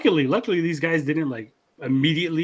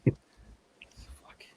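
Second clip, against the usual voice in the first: usual speaking voice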